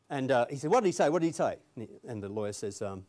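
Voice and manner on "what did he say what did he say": higher, louder repeated question; impatient demand. Retold or imitated. Mister Big presses the interpreting lawyer, urgent and impatient. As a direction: act out a gangster pressing urgently for the answer.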